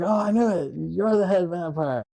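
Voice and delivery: high-pitched, put-on aha moment; hammed-up exposing of a culprit; mock accusation. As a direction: imitate a kid triumphantly calling someone out. Sam's retold accusation of Max.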